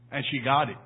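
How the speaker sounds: ordinary delivery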